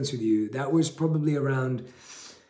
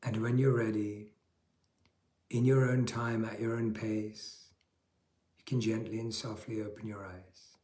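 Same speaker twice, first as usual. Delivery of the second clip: guided meditation; 2 semitones lower, 6 dB quieter than usual. Acted. Leads a guided breathing meditation.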